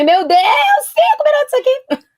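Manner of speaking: high, laughing cry of surprise; startled dismay. Real, not acted. Guest realizing only five minutes remain, surprised high laughing cry. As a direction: yelp of alarm at the clock, half laughing. Realizes show time running out.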